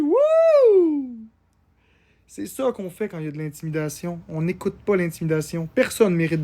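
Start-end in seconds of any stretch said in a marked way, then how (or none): 0.0-1.3 long, high-pitched cheer; excited high whoop; celebratory whoop. Real, not acted. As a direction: let out a drawn-out, falling woo. Cheering a big gift.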